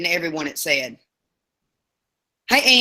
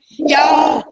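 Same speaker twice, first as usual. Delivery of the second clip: shout; 6 semitones higher, 6 dB louder than usual. Real, not acted. Calling out to the viewers.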